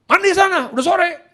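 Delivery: high, loud mock scolding; snappish father. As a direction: imitate a dad barking a high-pitched order. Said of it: "Dia kalau ngomong nyolot begitu".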